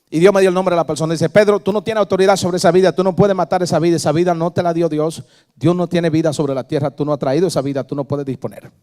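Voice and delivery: loud, fast preacher's decree; fervent pulpit proclamation; loud prophetic declaration. Acted. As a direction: command it like a prophet.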